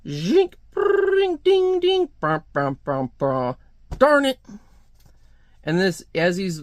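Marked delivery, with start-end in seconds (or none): 0.1-3.5 mouth-made machine sound effects; slot machine noises. Acted. 4.0-4.4 high, loud mock-angry cry; acted frustrated yelp of a losing gambler; angry outburst. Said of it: "He's angry".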